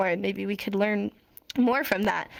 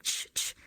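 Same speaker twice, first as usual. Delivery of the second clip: "ch, ch" as soft breathy teeth bursts; quiet unvoiced "ch" demo sounds; voiceless ch bursts; 6 dB quieter than usual. Shown as a demonstration. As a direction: push air through the teeth, no voice. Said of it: "the sound you're gonna make".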